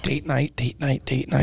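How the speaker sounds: hushed. Retold or imitated. Describing a hushed date-night restaurant.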